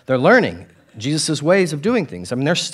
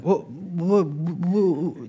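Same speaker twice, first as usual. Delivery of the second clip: stammering disciple; 4 semitones higher, 4 dB quieter, much slower than usual. Retold or imitated. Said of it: "this is me responding".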